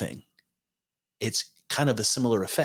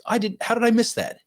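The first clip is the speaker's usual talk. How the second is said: much higher, incredulous voice; retold disbelief; 12 semitones higher than usual. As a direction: act amazed you missed the news. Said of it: "And I'm like".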